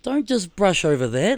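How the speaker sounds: high, loud, playful objection; re-enacted excited outrage; retold incredulous protest. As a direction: protest as if big news was skimmed past. Mock protest at glossed-over news.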